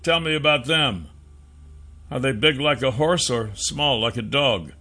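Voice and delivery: lightly voiced, curious hero; questioning hero. A narrator's reading. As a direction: gently draw answers from a child.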